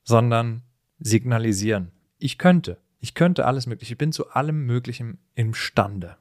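normal talking voice

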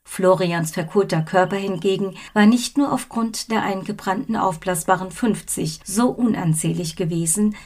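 ordinary delivery, nothing marked

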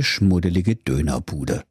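ordinary delivery

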